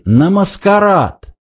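high, slow, understated rage; trembling with fury. A narrator's reading. Arthur finishes his accusation, with contained fury. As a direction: draw out the accusation. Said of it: "дрожа от ярости".

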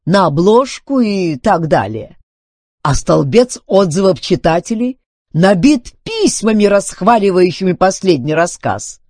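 exultant. A narrator's reading. Duncan shows Filbert the readers' praise, exultant. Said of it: "ликуя воскликнул он".

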